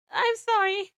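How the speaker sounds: high, loud, choked-up cry; tearful apology. A narrator's reading. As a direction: apologize through acted tears. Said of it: "My voice was choked by tears and ragged with raw emotion".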